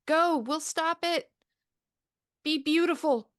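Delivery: high, loud creature voice giving a last command; the creatures urge them to flee; creatures' farewell. Acted. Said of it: "and saying".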